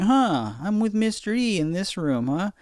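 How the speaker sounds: put-on high, puzzled little-kid voice; mock kid voice. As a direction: squeak it like a confused kid reading a list. Kids reading class lists.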